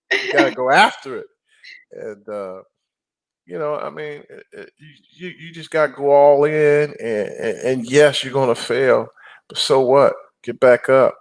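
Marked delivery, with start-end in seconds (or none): none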